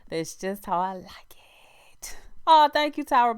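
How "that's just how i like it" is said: a private whispered aside. Real, not acted. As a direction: whisper it to yourself.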